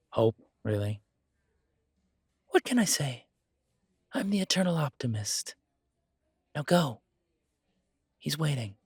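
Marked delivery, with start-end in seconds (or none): 2.5-8.8 lightly higher, upbeat nudge; cheerful barkeep sending him off; hopeful barkeep. Acted. Trey encourages Caleb.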